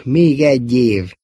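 slow, stubborn insistence; obstinate, deliberately slow repeat. A narrator's reading. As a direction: draw it out and dig in. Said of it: "hajtogatta magáét konokul".

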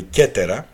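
κέτερα as "KEH-te-ra", k in place of s; hard k reading. Shown as a demonstration. Lecturer explaining that c also reads as k, hard-k pronunciation. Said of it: "διαβάζεται και κάπα κέι".

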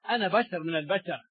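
ordinary delivery

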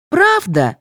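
high-pitched little yelp; high, quick-tempered comeback; yapped retort. A narrator's reading. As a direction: pipe up in contradiction. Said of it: "вякнула".

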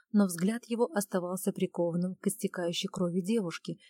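plain reading voice

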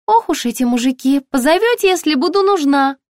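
very high, chirpy shop assistant; high-pitched, ringing sales voice; ringing saleswoman voice. A narrator's reading. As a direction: pipe up brightly through the door.

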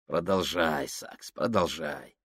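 тише и ниже, медленно, с угрозой; процеди с тонкой улыбкой; холодная угроза. Acted. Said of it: "улыбка, тонкая, как бритва". (Фабрини угрожает Саксу, тихо и холодно)

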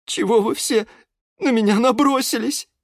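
high, wounded whine; high-pitched tearful plea; close to tears. A narrator's reading. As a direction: protest as if about to cry. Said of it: "чуть не плакала герань Антонина".

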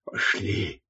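low, soft, resigned exhale; breathed out. A narrator's reading. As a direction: let the word out on a sigh. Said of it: "и выдохнул".

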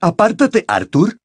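cold order, starting high; cold, cutting. A narrator's reading. Crouch ordering the father aside, cold and cutting. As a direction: cut him off icily. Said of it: "dijo una voz fría y cortante".

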